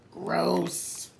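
ordinary delivery

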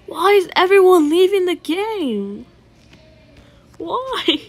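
high, loud whine at the lobby emptying; indignant whine. Real, not acted. Player whining at the emptying lobby, high and loud. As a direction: complain loudly that everyone bails.

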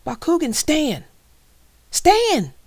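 high, full-voiced yell, stretching the last one. A narrator's reading. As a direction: yell the command with growing desperation. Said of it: "Dan yelled".

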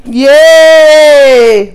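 loud high yay; cheering. Real, not acted. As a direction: cheer like you won. Cheering finish.

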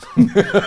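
high, loud laugh; burst of laughter. Real, not acted. Laughing at the thought of a late child, high and loud. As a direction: laugh at the idea of a little rebel. Laughs at his own joke.

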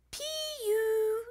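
high, drawn-out pee-yew; high-pitched disgusted exclamation; disgusted at a stink. A narrator's reading. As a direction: complain of a stink, stretched out high. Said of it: "holding her nose".

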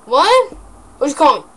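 indignant. Real, not acted. Indignant reaction to being called something.

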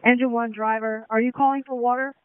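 yelling over fire hose. Real, not acted. Radio call on fireground.

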